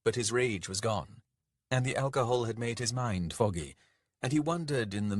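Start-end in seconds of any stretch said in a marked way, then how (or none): none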